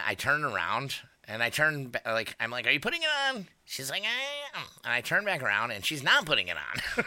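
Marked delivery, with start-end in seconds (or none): 2.7-3.5 high pitched imitation of himself; mock high voice; high mock question. Asking drunk woman. 4.0-4.6 high, quiet coy reply; mock high coy voice; high mock answer. Drunk woman answering.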